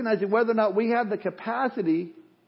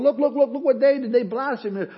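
higher and louder, gleeful accusing "look, look, look"; mock pointing finger; 6 semitones higher, 4 dB louder than usual. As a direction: play the scoffing onlooker catching Christians out. Preacher voices the mocking world.

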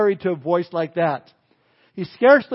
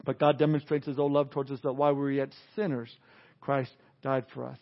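soft, low reverent scripture reading; hushed Bible reading; 5 semitones lower, 9 dB quieter than usual. Acted. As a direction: read the verse quietly and gently. Reading Romans five eight.

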